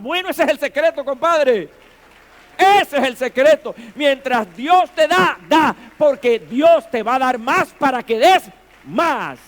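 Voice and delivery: shouted, high-pitched sermon climax; fervent preacher's shout, very loud; full-force preaching. Real, not acted. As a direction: drive the point home at a shout.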